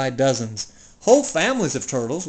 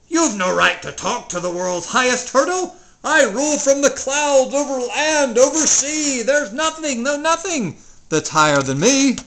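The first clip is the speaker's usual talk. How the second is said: angry boasting king; 7 semitones higher, 5 dB louder than usual. Acted. King keeps howling at Mac.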